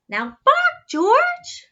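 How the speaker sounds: very high, loud call; shrill command. A narrator's reading. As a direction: squeal the order. Mother commands dog.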